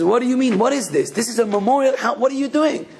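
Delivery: high-pitched, urgent retold questioning; shocked parents. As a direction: imitate alarmed parents demanding an explanation. Shocked parents confront their daughter.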